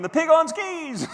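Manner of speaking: high, slow, laughing through it; giggling at his own pink suit on skis. Retold or imitated. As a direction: crack yourself up as you say it. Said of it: "give yourself the giggles".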